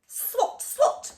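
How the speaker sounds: sharp swatting noise; swat sound. A narrator's reading. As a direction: snap out each swat. Mop swatting.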